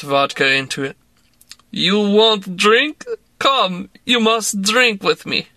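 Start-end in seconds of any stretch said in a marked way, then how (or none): 1.7-5.5 put-on slow, higher Russian-accented woman coaxing; tipsy Russian woman urging a drink. A narrator's reading. Woman pressing vodka on narrator, in a Russian accent.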